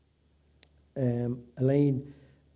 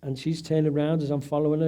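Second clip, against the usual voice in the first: usual speaking voice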